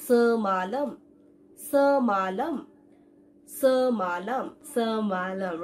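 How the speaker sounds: সমালম as 'suh-maa-lam', syllables drawn out; model pronunciation. Shown as a demonstration. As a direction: sound সমালম syllable by syllable with a held schwa. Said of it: "ই দিয়ে যদি আমি একটা Malay word". (সমালম, টেনে টেনে 'সə-মা-লাম' উচ্চারণ)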